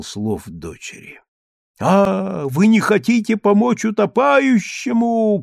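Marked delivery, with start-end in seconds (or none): 1.8-5.4 high, loud furious accusation; frenzied shout. A narrator's reading. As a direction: rage at cowards who won't help. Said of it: "кричал он неистовым голосом".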